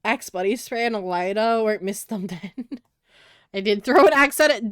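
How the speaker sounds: ordinary delivery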